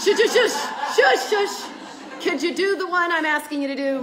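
God shushing her while laughing. Pretend. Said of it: "He goes".

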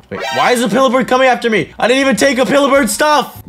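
fast, high-pitched alarm; panicked protest. Real, not acted. Player chased by Pillabird protesting innocence, high panicked voice. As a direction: protest in a rush, pitch climbing.

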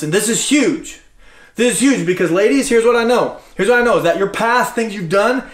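usual speaking voice

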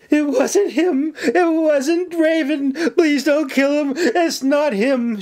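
very high, drawn-out wail; slow, high, distraught plea; hysterical pleading. A narrator's reading. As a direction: beg desperately for a life. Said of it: "she pleaded".

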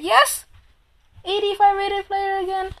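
loud, high cheer; triumphant shout. Real, not acted. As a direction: celebrate the pull. Scores a good player.

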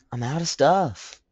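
high, slow, hammed-up bad accent on "I'm out of stuff"; a laughing, badly faked accent, drawn out; horrible accent. Gamer mocks himself in a horrible accent. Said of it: "that was a horrible accent".

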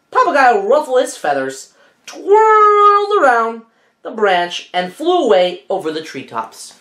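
lively read-aloud storytelling; story narration. A narrator's reading. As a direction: read it like a bedtime picture book.

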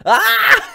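sudden high shriek; startled scream. Real, not acted. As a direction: shriek in fright. Screams over the game.

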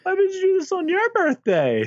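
high, whiny imitation of crying; mock sobbing. Man acting out his fiancée's surprise at the proposal, mock sobbing. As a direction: wail it in a squeaky, put-on tearful voice. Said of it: "like, sobbing. She's just like".

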